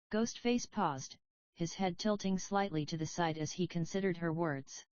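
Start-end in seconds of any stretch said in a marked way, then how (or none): none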